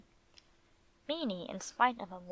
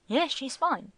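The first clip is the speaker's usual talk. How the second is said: higher-pitched, eager answer; enthusiastic; 5 semitones higher, 3 dB louder than usual. A narrator's reading. Beanie tells Gringo about his visit, enthusiastically. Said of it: "said Beanie enthusiastically".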